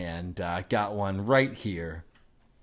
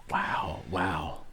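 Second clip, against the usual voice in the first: awed whisper, lower; whispered wow; 5 semitones lower, 3 dB quieter than usual. Acted. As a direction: breathe the wow in secret wonder. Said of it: "the reason we're whispering".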